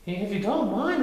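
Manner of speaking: high, timid, a touch shaky; trembling Piglet. A narrator's reading. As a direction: hold back fear politely. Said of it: "trying to keep his ears from trembling".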